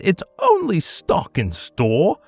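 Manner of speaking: usual speaking voice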